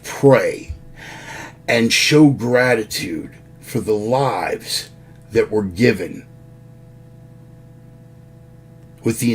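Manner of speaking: honour the fallen gravely, almost a eulogy; solemn tribute. Acted. Solemn Memorial Day tribute.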